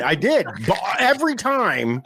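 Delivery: ordinary talk, nothing marked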